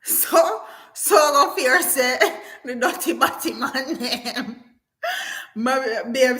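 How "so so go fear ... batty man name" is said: talking through laughter; laughing. Real, not acted. Reading a viewer's comment while laughing. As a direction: let the laugh break the words.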